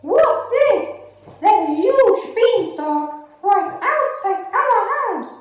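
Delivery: high, excited calling out; astonished, calling Jack over; surprised mother. Acted. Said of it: "looked out of the window and said".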